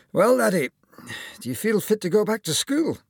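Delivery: high, loud Scottish surgeon; smiling Scottish accent. Acted. Surgeon at the final appointment, smiling Scottish accent. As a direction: ask it with a smile and a Scottish lilt. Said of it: "the smiling surgeon said".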